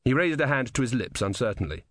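usual speaking voice

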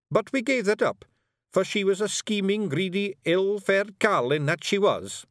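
high, loud wee Scots warrior voice; Scottish Feegle Rob. A narrator's reading. Rob Anybody brags to Miss Level, high Scots Feegle. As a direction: play a cocky little Feegle, high and brash.

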